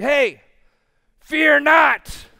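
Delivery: a sudden loud shout of a preacher yelling at the crowd; mock yell. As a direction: bark it out loud to show the wrong way to preach. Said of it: "He's yelling".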